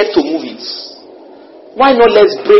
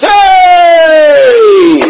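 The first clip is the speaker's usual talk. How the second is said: long, high, loud mock yell of disbelief; imitated shout; 14 semitones higher, 9 dB louder than usual. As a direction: holler it drawn out, imitating an astonished man. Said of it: "He practically was shouting".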